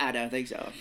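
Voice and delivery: lightly voiced famous actor impression; a rough, half-hearted celebrity voice; a hint of a celebrity impression; Morgan Freeman. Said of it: "I don't know why I'm doing the voice like that".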